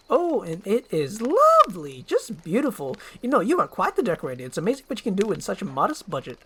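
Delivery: a motor-mouth sidekick gushing praise; fast-talking eager donkey. Acted. Donkey flatters the cottage.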